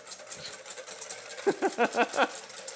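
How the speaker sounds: silent wheezing laugh; breathy wheeze laugh. Real, not acted. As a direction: laugh with no voice, just air. Laughing at creepy vibes.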